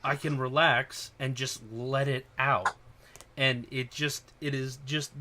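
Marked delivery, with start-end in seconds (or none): 0.0-2.8 big loud "oh", then slow release; drawn-out, relieved exhale into words; acted relief. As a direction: let it all out with slow relief. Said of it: "relieved. They have this sense of".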